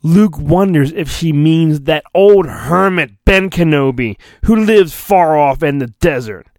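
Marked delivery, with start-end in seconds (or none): none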